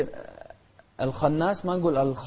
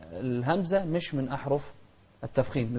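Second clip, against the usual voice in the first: usual speaking voice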